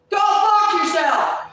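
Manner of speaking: loud, high, drawn-out yell; full-volume furious retort; angry shout. Retold or imitated. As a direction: scream the insult, stretching it out. Acting out cursing someone off.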